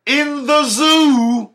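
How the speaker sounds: loud, high, drawn-out outburst; outraged shout. Real, not acted. Outraged rant driving home the point.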